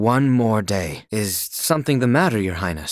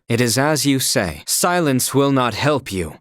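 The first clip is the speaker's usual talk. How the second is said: ordinary talk, nothing marked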